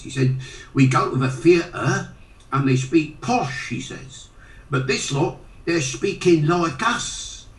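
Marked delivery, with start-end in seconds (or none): 0.7-3.7 higher-pitched Cockney imitation; retelling in a Londoner's Cockney; strong Cockney accent. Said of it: "in a strong Cockney accent told me". 4.7-7.5 high, drawn-out Cockney imitation; retelling in a Londoner's Cockney; strong Cockney accent. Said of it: "in a strong Cockney accent told me".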